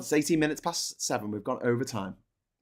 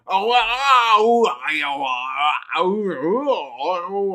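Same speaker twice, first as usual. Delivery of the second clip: loud high wavering wail; radio-tuning noise; 4 semitones higher, 5 dB louder, much slower than usual. Acted. Mocking his co-host's accent, wailing radio-tuning noise. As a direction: swoop like a radio being tuned in. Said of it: "like someone trying to tune in a radio".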